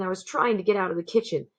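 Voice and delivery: ordinary delivery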